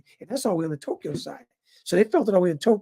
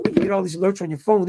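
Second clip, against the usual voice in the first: normal talking voice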